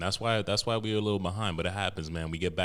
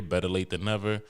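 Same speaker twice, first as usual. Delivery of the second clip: usual speaking voice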